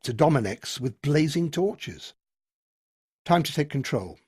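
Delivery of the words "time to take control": usual speaking voice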